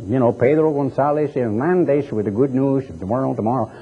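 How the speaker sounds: mock radio greeting in a Mexican Spanish accent; thick Mexican accent. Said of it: "If he'd have had a thick accent".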